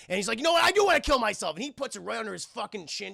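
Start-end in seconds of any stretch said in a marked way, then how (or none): 0.4-1.5 high, louder acted confession; mock outburst. Suicidal cop snapping back at his partner, high and loud. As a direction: throw it back in a heated argument.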